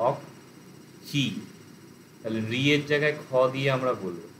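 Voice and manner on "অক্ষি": অক্ষি broken into its syllables, very slow and barely voiced; split into syllables. Shown as a demonstration. Teacher breaks the word for students into syllables. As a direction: spell out অক্ষি syllable by syllable, drawn right out. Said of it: "syllable ভেঙে নিতে হবে".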